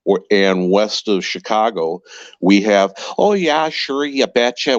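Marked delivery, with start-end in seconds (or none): 3.2-4.8 put-on Minnesota accent. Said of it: "We have the Minnesota accent".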